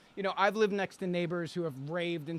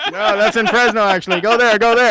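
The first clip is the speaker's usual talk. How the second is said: louder, laughing misdirection; loud laughing joke; 3 semitones higher, 15 dB louder than usual. Pretend. Teasing the internet with a fake location, loud and laughing. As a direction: shout a jokey fake tip.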